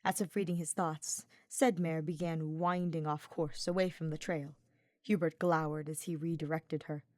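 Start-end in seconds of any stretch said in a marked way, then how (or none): none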